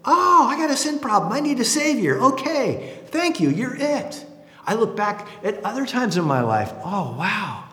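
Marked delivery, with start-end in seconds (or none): 0.0-4.2 put-on little kid realizing. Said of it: "I went".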